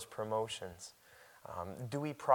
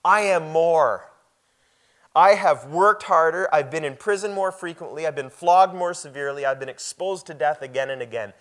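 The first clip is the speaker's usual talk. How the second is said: loud, high, ringing scripture reading; preaching at full force; 4 semitones higher, 6 dB louder than usual. Acted. Pastor to congregation, reading Paul's boast, loud and ringing. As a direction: proclaim each question like a challenge. Reads Paul's list of hardships.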